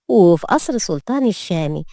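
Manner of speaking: ordinary delivery, nothing marked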